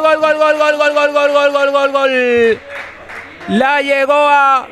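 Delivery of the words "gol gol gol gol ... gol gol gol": goal call. Real, not acted. Goal scored, live commentary.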